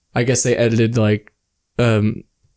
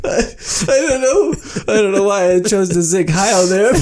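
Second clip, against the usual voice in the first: laughing; 7 semitones higher than usual. Real, not acted. Talks through laughter.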